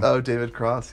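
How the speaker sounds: ordinary delivery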